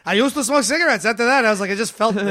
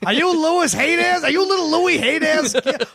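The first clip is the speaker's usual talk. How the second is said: high, drawn-out amazement; delighted recognition; 7 semitones higher, slower than usual. Pretend. Old performer recognizing a colleague's great-grandchild, delighted. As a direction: greet a long-lost kid with slow, rising delight. Acted recognition of long-lost acquaintance.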